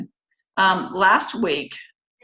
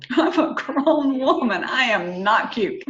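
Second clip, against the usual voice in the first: laughing; 4 semitones higher than usual. Real, not acted. Laughs at own point.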